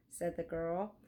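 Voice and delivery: plain reading voice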